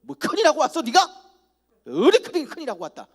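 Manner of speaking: high, loud mock scolding; imitating a stern father rebuking his son. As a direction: tell the son off sharply. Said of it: "뭐 이렇게 해야 되는데".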